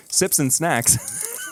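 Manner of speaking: slow showy title read; segment announcement. Pretend. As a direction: present "Sips and Snacks" like a show title.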